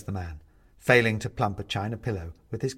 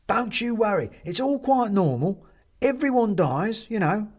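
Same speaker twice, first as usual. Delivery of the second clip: high, strong character voice; reassuring china man; 6 semitones higher, 4 dB louder than usual. A narrator's reading. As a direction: comfort a confused newcomer heartily.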